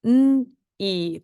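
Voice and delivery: "N-e" as a hummed n, then a loud long E; sounded out. Shown as a demonstration. Teacher spelling the second syllable, sounded out. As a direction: pull "N-e" apart into its two sounds. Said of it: "let's spell the second syllable".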